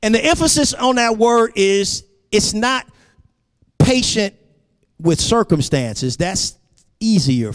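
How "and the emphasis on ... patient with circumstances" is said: high, ringing sermon delivery; emphatic preaching. Real, not acted. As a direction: preach it with rising intensity, pitch held high.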